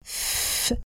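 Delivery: ف as a voiceless f hiss; f sound. Shown as a demonstration. Teacher isolating the f sound. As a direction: breathe out a voiceless f. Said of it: "بحرف الإف".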